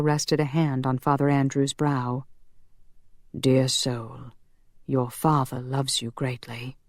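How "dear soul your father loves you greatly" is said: solemn angel blessing. A narrator's reading. Zachriel takes the priest's soul, solemn.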